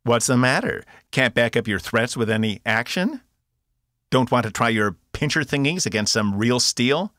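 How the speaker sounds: loud, high mocking jeers; loud goading mockery; taunting. A narrator's reading. Box taunting the guard.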